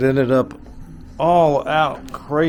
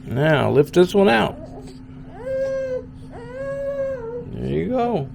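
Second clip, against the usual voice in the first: put-on higher, sing-song coaxing to a baby; baby talk; 11 semitones higher than usual. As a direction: coo encouragement at the little one. Parent cooing to crawling baby.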